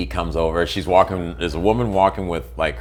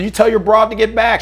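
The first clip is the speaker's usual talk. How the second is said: much higher, louder mock yell; retold shout back; 13 semitones higher, 5 dB louder than usual. Narrator retelling how he shouted at the husband. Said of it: "I was".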